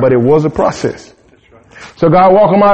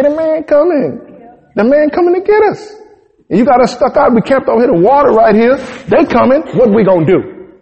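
much higher panicky whining; high, hammed-up complaining of the Israelites; 5 semitones higher than usual. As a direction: whine it like scared people blaming their leader. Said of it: "Just start complaining, acting stupid".

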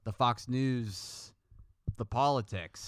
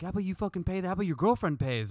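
argumentative; 8 semitones higher, faster than usual. Retold or imitated. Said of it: "just argumentative".

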